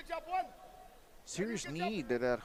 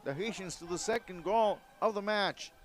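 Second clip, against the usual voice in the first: usual speaking voice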